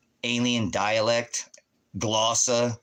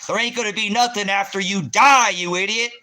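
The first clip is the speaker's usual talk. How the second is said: mock shout; 11 semitones higher, 6 dB louder than usual. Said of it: "He said".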